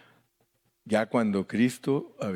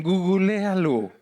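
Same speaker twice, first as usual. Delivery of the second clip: drawn-out Spanglish, higher pitched; Mexican Spanglish; 7 semitones higher, 3 dB louder, much slower than usual. Retold or imitated. Preacher imitates how some Mexicans talk, playful Spanglish. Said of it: "algunos hasta dicen".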